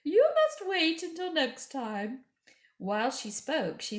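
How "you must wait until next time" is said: high, sweet coaxing queen; high, coaxing witch-queen voice; queen's voice. Acted. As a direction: lure the boy in with a high honeyed voice. Queen speaks.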